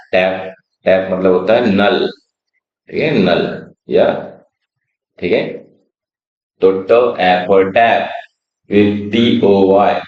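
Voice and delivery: normal talking voice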